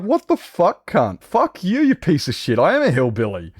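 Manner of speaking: re-enacted high-pitched outburst; mock-furious retort, starting high and dropping; retold indignant reply. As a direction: replay your outraged comeback, voice jumping high. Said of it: "That was my reply".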